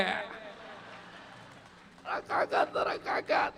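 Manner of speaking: whispered, drawn-out wail of a grieving widow; mock wailing. Widow wailing for her dead husband to the boy, hushed mock lament. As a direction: stretch the kaka into a hushed lament. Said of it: "રોતા રોતા કીધા".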